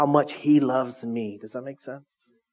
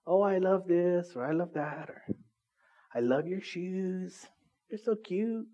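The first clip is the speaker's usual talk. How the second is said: soft, slow gushing over shoes; mock gushing compliment; gushing fan; 3 semitones higher, 7 dB quieter, slower than usual. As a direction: coo it like a gushing friend. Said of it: "It's just an expression that we say".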